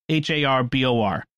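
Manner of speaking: ordinary delivery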